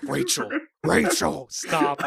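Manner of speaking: lightly voiced Batman growl, slow. Telling Rachel to stop, hint of a Batman voice. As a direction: say her name slow like Batman. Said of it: "why do you sound like Batman".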